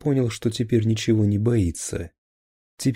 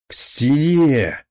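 slow, drawn-out roar, louder and higher; roaring order; 7 semitones higher, 7 dB louder, much slower than usual. Acted. Captain roars at officers to face the wall. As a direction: bellow it at the officers. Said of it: "ревел Хонинов".

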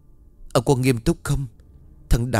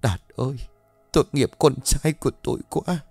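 high-pitched, tearful mourning; sobbing lament; 5 semitones higher than usual. A narrator's reading. Mother pitying her dead son, sobbing lament. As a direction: mourn in a raised, sniffling voice. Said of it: "Bà Sáu liền sụt sùi".

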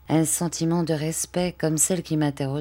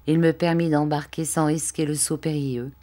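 usual speaking voice

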